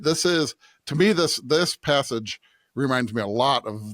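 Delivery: usual speaking voice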